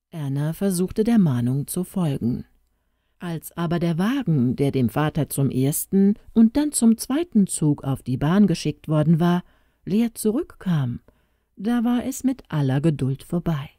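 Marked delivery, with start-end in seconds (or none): none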